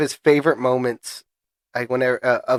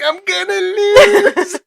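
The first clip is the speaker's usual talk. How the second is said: loud, very high comic cry, dragged out; hammed-up wail of defeat; mock panicked wail; 7 dB louder, much slower than usual. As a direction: squeal it while breaking into laughter. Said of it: "I'm like".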